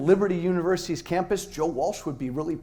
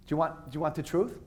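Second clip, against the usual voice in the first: ordinary delivery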